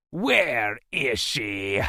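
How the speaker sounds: slow, drawn-out alien captor; menacing creature voice, words stretched; gritted out. A narrator's reading. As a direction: grit out a slow demand. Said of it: "he gritted out".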